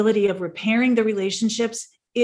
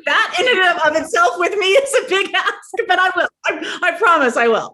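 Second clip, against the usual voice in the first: laughing, louder and higher; 8 semitones higher, 6 dB louder than usual. Real, not acted. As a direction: laugh through a playful promise. Laughing at the big ask.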